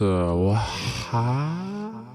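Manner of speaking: explanation trailing into a long, rising "whaaa"; derailed bewilderment. Acted. Explanation derailed by frame rate drop.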